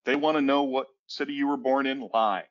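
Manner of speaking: ordinary talk, nothing marked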